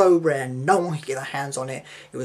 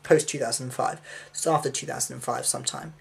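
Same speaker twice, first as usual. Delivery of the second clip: ordinary delivery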